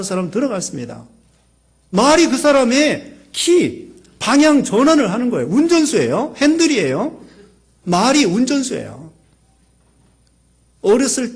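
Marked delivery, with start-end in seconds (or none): 1.9-9.1 fervent preaching. Real, not acted. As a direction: preach it out at full force to the congregation. Preaching at full force.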